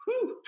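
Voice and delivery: high, loud "whew" at a bad smell; playful whoop of breath, recoiling from the whiff; mock whew. Host joking about keto breath, mock whew.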